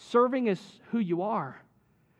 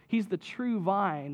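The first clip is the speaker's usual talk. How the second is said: normal talking voice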